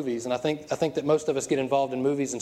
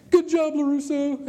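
high, drawn-out cheesy praise; slow, loud-ish hammed-up tribute; mock congratulation; 11 semitones higher, 4 dB louder, slower than usual. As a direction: stretch it out high like an over-earnest movie rival. Rival hands over trophy.

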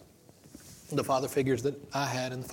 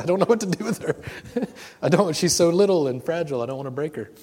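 higher, louder, laughing mock fluster; half-laughing, hammed-up worry, raised pitch; mock new-parent panic; 5 semitones higher, 8 dB louder than usual. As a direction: play the nervous dad scared of hurting a baby. Re-enacts panic holding fragile baby.

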